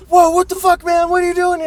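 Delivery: high, loud mock shout; freaked-out shout. Pete shouting at the homeless guy in his car, high mock yell. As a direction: yell it like a startled drunk.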